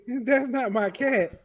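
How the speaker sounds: high, slow, shaking with laughter; laughing through it. Retold or imitated. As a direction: squeeze it out mid-laugh. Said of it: "and you say".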